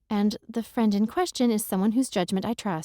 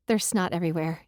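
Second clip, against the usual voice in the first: ordinary delivery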